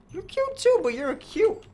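very high, cutesy squeak; high cooing rat voice. Acted. Rat cooing back at a cute rat. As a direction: coo it like a tiny flattered rat.

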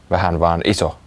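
plain reading voice

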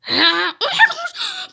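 high, drawn-out alarm; play-acted fright. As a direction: stretch it in squealed mock horror. Startled by zombie.

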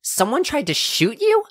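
can't believe someone shot at them; shocked. Acted. To the guard who mentioned shootings, shocked and alarmed.

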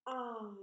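I drawn out, ah gliding to ee; long vowel I. Shown as a demonstration. Teacher shows the changing mouth, I stretched out. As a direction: hold the letter name I long. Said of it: "You try it with me".

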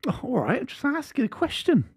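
high-pitched feigned innocence; mock offended. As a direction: protest in a very high, put-on hurt voice. Rebuked, plays the hurt innocent.

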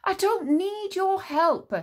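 high, loud, slow defiance; defiant boy. A narrator's reading. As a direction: push the help away. Tsar angrily refusing help.

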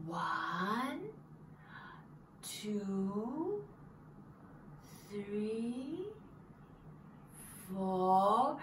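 slow hushed count bursting on the last number; slow count to five. Shown as a demonstration. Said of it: "closing your eyes".

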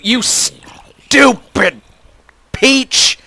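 high, loud, drawn-out outburst; exasperated shout. Real, not acted. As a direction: yell it in bursts at a game enemy. Yelling at a game character.

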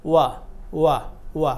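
ওয়া repeated with a very light touch. Shown as a demonstration. Teacher drilling students on the light ওয়া. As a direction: keep each ওয়া feather-light. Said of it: "খুব হালকা করে".

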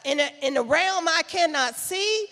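loud, driving preacher's voice; full-force preaching. Acted.

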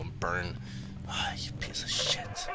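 whispering. Real, not acted. Whispered curse at monster.

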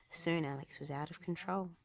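plain reading voice

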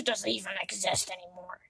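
a hushed aside; whisper. Acted. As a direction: whisper it under the breath. Side remark whispered.

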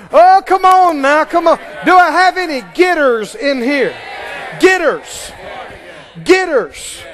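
loud, shouting preacher; full-force preaching. Acted. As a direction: fire up the congregation.

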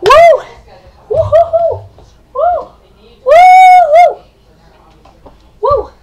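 very high, loud woo-hoos; string of shrieked cheers; celebratory whoops. Pretend. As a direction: whoop in overblown relief. Celebrates everything back to normal.

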